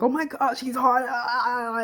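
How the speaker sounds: mock swooning fan; mock fangirl gush. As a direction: swoon over him playfully. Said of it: "I don't want it to just be like".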